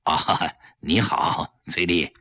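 slow, low, effortful politeness; husband greeting his wife's guest with a forced smile; forced friendly greeting. A narrator's reading. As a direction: push out a smile you don't feel. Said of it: "强打笑脸和崔丽打招呼".

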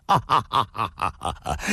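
rolling "ah-ha-ha-ha" laugh; big laugh in character; hearty guffaw. A narrator's reading. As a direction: burst into acted laughter. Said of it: "龙啸云哈哈大笑说道".

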